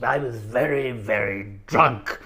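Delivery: put-on slow, slurred posh old club gentleman; Roly Birkin QC. Old club gentleman ending his garbled anecdote, slow drunken impression. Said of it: "the only thing you could understand him saying".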